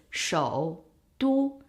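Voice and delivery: 首都 slowly split, full third tone on 首; separate full tones. Shown as a demonstration. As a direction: pronounce 首都 as two separate syllables, 首 falling and climbing back. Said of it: "it needs to sound like this".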